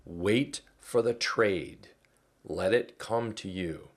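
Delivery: slowed down, leaning on the word for; drawn-out motto, every word weighted; slow, deliberate mantra. Shown as a demonstration. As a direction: spell out the trading rule slowly. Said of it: "Remember these words".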